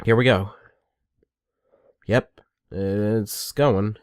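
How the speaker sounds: slow, bored mock commentary; mock bored viewer, slowed right down. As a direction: drag it out like you're utterly unimpressed. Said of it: "just matches where it's like".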